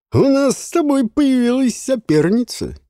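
high, loud delighted call-out; excited teasing call. Acted. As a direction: show off to a friend. Жуан дразнит Ворона. (восторженный возглас)

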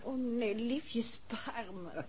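soft, slow, playful plea to be spared; drawn out and pleading, half joking; mock plea. Wife teasingly begs her husband to spare her, slow soft plea. Begging off the merry-go-round.

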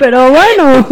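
high, loud exclamation through laughter; laughing protest. Real, not acted. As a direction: protest while cracking up. Teasing between co-hosts, all laughing.

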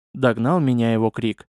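usual speaking voice

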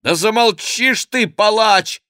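high, loud cry of protest; tearful exclamation. A narrator's reading. Henri begs his tormentor to stop, tearful high outcry. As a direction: cry out at him, close to tears. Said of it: "воскликнул Генрих со слезами на глазах".

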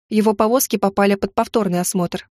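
ordinary delivery

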